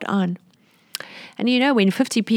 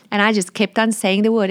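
normal talking voice

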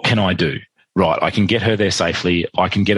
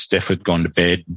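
ordinary talk, nothing marked

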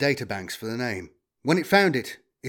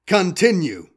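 loud, raised machine command; robot prompting; 6 semitones higher, 7 dB louder, slower than usual. A narrator's reading. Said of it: "it prompted".

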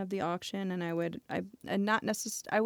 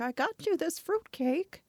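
lightly voiced doting old grandpa, pitched up; sweet grandpa voice; 9 semitones higher than usual. Granddad greeting his granddaughter with a fruitcake, lightly voiced. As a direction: play a kindly old man handing over a gift. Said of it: "he could be like".

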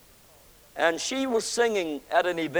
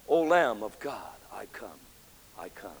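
hushed surrender; 8 semitones lower, 5 dB quieter than usual. Acted. Preacher hushes the hymn's last words to the congregation, quietly. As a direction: let the hymn fade into a soft hush. Hymn words, fading to a hush.

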